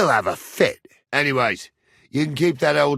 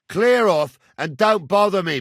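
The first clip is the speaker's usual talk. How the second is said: high, raised brush-off; irritated dismissal; 6 semitones higher, 3 dB louder than usual. Acted. Man telling blacksmith's boy to clear off, irritated. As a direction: shoo him away crossly.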